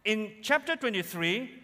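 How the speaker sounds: normal talking voice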